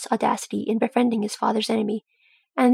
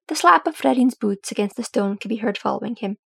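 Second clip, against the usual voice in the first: plain reading voice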